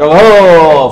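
loud, drawn-out whoop; triumphant cry. Acted. Successful soul receiving the paper, loud triumphant cry. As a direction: let out a long victory call. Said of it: "وہ ایک نعرہ لگائے گا".

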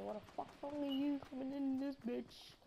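a put-on voice, mock jump-scare taunt; lightly voiced prank taunt; imitated ambush taunt. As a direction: pop out and mock the enemy in a silly voice. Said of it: "hide inside and then pop out and go".

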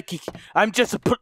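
ordinary delivery

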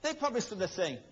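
usual speaking voice

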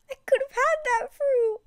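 high, loud mock crying wail. Gamer lamenting the lost fruit to viewers in a mock crying wail. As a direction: wail like a hammed-up crybaby.

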